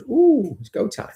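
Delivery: a sudden high, loud "Ooh", then eager; excited dog voice. Pretend. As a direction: voice the dog, thrilled it's go time. Voicing his dog rushed by another.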